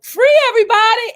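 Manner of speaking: high, fast announcer call; high quick red carpet call; announcing Free. Retold or imitated. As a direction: call her name out to the crowd. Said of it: "And I'm just like".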